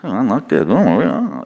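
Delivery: grumbling with wildly swooping pitch; exaggerated put-on grumble; mock bad attitude. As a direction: act out a sulky attitude, voice swinging up and down. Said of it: "with your attitude".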